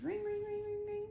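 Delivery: high sing-song ring ring; ringing phone. A narrator's reading. Reader imitating the phone ringing high.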